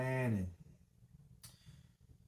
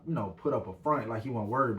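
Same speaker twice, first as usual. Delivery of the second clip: normal talking voice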